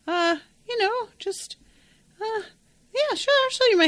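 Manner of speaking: high squeaky flustered innocence; nervous fake innocence. Caught aunt to her nephew, high nervous fake innocence. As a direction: squeak it out, caught red-handed.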